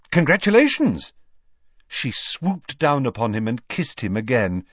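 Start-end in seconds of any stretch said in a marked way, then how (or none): 0.1-1.1 loud, high forced congratulations; forced cheer. Acted. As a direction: overdo the enthusiasm. Harry forced enthusiasm.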